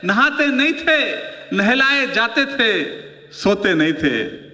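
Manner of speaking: slow, sing-song poem delivery; poem recital. Acted. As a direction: recite the verse slowly, savouring it. (धीमी कविता पाठ की लय)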